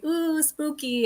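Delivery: high, slow mock spooky coo. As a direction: tease it as spooky, drawn out.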